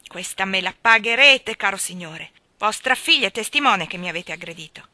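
loud, seething threat; livid. A narrator's reading. Injured Wakem threatening the miller. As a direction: promise revenge through anger. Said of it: "con una rabbia verde".

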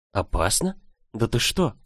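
questioning echo, rising in surprise; understated, puzzled disbelief; surprised. A narrator's reading. Girl stares at his serious face, mildly surprised. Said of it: "Я с удивлением уставилась".

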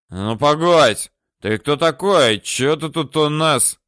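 put-on loud, slow drunken challenge. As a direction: bark it like a drunk picking a fight.

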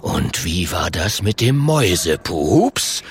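low bear voice, swooping up at the end; deep, probing rumble; menacing bear. Acted. The bear questions Klonk about insult, menacing.

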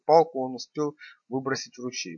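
ordinary delivery, nothing marked

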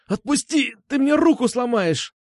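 high, panicked squeal. A narrator's reading. Boy fears his arm will break, squealing. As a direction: squeal in pain and alarm. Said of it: "завизжал он".

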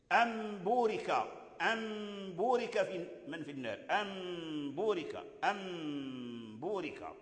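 "أم بورك" as am-boorika with a long hummed M; slow, soft teaching recitation, lingering on the M of "أم"; iqlab before ba. Shown as a demonstration. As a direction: stretch the nasal in "أم", then release "بورك". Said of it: "تقلب عند الباء".

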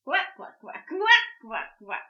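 loud, slow croaking laugh; laughing frog croak. Acted. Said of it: "засмеялась лягушка".

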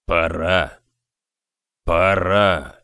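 low, eerie grave-like mutter; deep murmuring voice. A narrator's reading. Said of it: "Невероятно глубоким голосом он бормотал".

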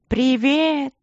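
high, mannered mocking voice; mock him in a prissy high voice; thin mincing mockery. A narrator's reading. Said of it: "передразнил его Ипполит тонким и манерным голосом".

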